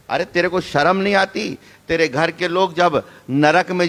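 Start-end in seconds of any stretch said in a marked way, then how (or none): none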